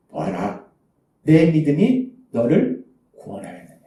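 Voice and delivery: low, softened voice of Jesus granting healing; gentle pronouncement. Acted. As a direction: speak tenderly and deliberately, low. Preacher voices Jesus healing the beggar.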